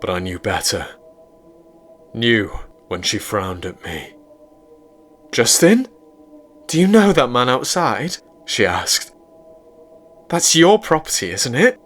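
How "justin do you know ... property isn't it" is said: higher, louder anxious questions; higher frightened girlfriend. A narrator's reading. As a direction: press him, getting scared. April frightened by man outside.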